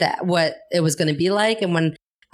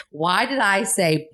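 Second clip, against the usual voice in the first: ordinary talk, nothing marked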